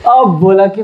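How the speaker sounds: ordinary talk, nothing marked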